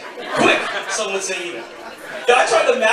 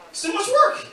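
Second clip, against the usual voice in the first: mock high whine; 14 semitones higher than usual. Repeating the punchline higher.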